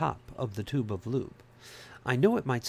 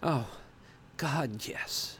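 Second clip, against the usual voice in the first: breathy moan fading out; 5 semitones higher, much slower than usual. A narrator's reading. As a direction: moan it, dropping to a whisper. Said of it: "I moaned".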